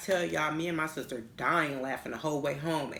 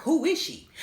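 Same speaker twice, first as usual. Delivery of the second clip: demanding yell; 11 semitones higher, 6 dB louder than usual. Retold or imitated. Demanding on the phone who she is.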